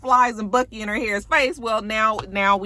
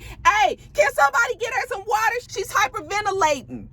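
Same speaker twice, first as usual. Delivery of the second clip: high, urgent call for help; mock alarm; 14 semitones higher than usual. Vlogger imitating castmates calling for water, high mock alarm. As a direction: call out fast as a mock-panicked bystander.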